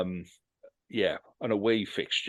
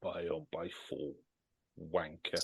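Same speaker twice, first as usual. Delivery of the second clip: deadpan scorn; 3 semitones lower, 10 dB quieter than usual. Real, not acted. Host repeating co-host's prediction, deadpan scorn. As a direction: repeat it very quietly, then drop the insult.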